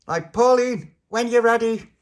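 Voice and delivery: high, loud cue; calling out. Acted. Little Pappy cueing Pauline to pull, calling out. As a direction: shout the signal across. Calling to pullers through wall.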